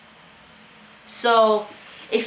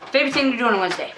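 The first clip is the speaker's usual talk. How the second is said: rushed; 3 semitones higher, much faster than usual. Real, not acted. Reading fan question fast.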